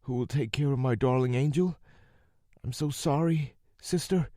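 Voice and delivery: a mild tearful voice; crying. A narrator's reading. Rosie crying alone after the call drops. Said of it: "She started crying".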